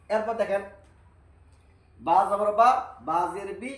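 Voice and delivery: ordinary delivery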